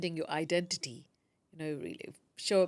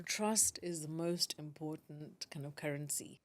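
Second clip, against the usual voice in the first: whispered; 4 semitones lower, 7 dB quieter than usual. Real, not acted. Stressing a key point softly.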